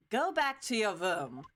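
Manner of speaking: a hint of a bad German accent, stern. Voicing Franziska ordering him back, bad German accent. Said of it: "now you can hear my German accent".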